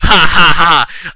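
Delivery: slow, spelt-out evil "ha ha ha"; mock villain laugh. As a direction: laugh deliberately like a hammy villain. Villain's laugh in fanfic reading.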